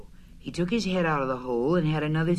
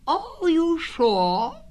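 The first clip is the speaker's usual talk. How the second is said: high, slow astonished question; still more surprised; 14 semitones higher, 4 dB louder, much slower than usual. A narrator's reading. Rabbit doubting it is Pooh, high astonished voice. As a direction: stretch it out in high disbelief. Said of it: "still more surprised".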